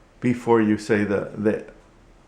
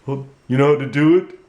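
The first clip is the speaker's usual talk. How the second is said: higher, bright puppet hellos; puppet greeting; 3 semitones higher, 4 dB louder than usual. Acted. Puppet Goodman greets the viewers, higher puppet voice.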